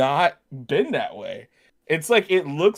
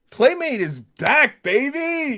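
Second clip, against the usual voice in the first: hype announcer; 9 semitones higher, 4 dB louder, much slower than usual. Acted. Excited toy announcement.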